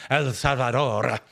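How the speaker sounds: "El Salvador" in a mocking Spanish accent, slow, R rolled; hammed-up Spanish accent on "El Salvador", slowly. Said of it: "try to start rolling R's and say it in the accent".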